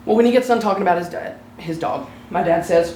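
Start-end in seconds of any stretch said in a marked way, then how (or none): none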